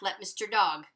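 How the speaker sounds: usual speaking voice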